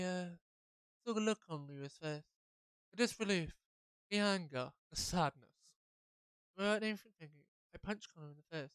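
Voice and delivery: normal talking voice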